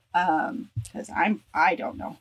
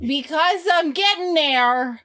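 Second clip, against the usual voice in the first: calling out; 7 semitones higher, 4 dB louder, slower than usual. Real, not acted. Calling out from another room.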